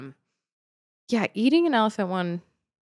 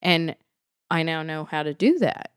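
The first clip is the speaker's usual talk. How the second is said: ordinary talk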